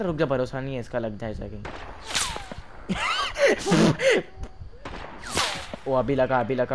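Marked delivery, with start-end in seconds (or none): 1.9-5.8 long squeaky burst of laughter; high-pitched laugh. Real, not acted. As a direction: let a high giggle run out. Laughs during game.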